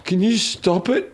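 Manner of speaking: a hint of a drunk stumbling out of the bushes; mild drunken voice; drunk friend. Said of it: "stumbles out of the bushes".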